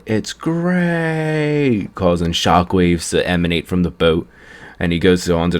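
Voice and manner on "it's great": high, pitched-up cartoon yell; a high mock scream of delight. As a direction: squeal it out like a thrilled god of destruction. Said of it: "Beerus screams out".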